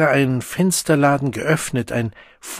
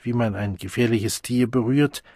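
plain reading voice

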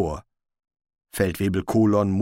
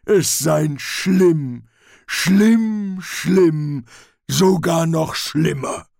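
loud, raised, drawn-out troll voice; emphatic troll; 7 semitones higher, 6 dB louder, much slower than usual. Acted. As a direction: hammer home the warning as a troll. Troll relishes the warning. (nachdrücklicher Troll, laut und gedehnt)

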